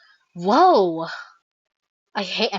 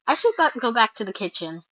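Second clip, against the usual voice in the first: ordinary delivery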